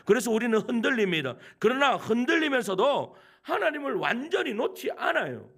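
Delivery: loud, ringing sermon questions; full-force preaching. Acted. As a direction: preach it hard to the congregation. (힘차게 외치는 설교)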